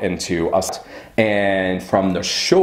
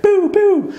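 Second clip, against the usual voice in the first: squeaky, very high mock whining; shrill mocking Boo, boo, far higher and louder; mocking whine; 4 dB louder than usual. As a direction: mimic his nagging in a squeal. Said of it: "he starts coming at me".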